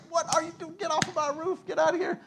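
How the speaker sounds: high-pitched shout to get off the roof; mock angry shout. Preacher playing himself shouting at roof breakers, mock angry. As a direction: chase them off, voice up high. Chasing people off his roof.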